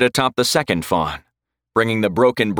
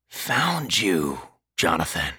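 much softer, eerie undead voice; 3 semitones lower, 6 dB quieter, slower than usual. A narrator's reading. The Draugr's voice taunts Jonathan inside his head, soft and eerie.

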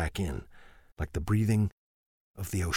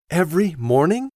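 high, loud disbelieving question; can't believe what she heard; wife, high and incredulous; 9 semitones higher, 7 dB louder than usual. Acted. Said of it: "she asked incredulously".

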